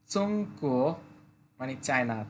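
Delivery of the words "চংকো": চংকো with the second syllable rising; চংকো, level then rising tone; Chinese tones. Shown as a demonstration. Teacher repeats চংকো slowly for the class. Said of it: "প্রথমটা first tone, পরেরটা second টোনে বলতে হবে".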